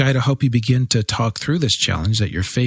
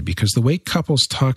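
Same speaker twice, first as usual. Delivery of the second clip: ordinary talk, nothing marked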